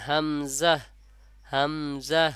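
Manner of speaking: হামজা said as "hamzah", with a buzzing z; correct z sound. Shown as a demonstration. Teacher answering how it must be said, হামজা with a z. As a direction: linger on m, then a clear z in হামজা. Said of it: "দেখেন".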